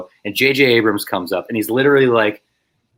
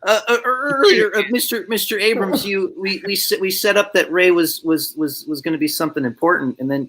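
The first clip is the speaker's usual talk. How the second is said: high-pitched halting babble; mock nervous stammer; 9 semitones higher than usual. Extra pretending to advise the director again, high flustered stammering. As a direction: stammer timidly as a running gag. Replays stammer as joke advice.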